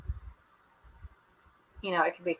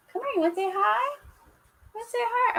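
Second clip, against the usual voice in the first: pet talk. Real, not acted. To her dog, coaxing it to say hi, high baby talk. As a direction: coax the dog sweetly, pitched way up.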